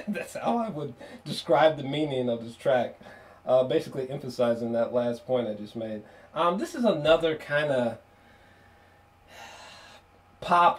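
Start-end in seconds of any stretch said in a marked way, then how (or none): none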